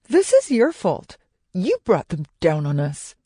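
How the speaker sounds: heated blaming outburst; angry accusation. A narrator's reading. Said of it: "His companion cursed and turned to Dewdrop".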